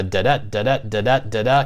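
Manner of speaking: sing-song da-DA, da-DA beat; sing-songy rhythm. Shown as a demonstration. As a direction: chant "da-da" in bouncing pairs, second "da" stressed. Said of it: "It does get a little sing-songy".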